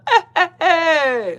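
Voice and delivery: slow, high, loud mocking chant; mock neighbor chanting. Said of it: "And they start".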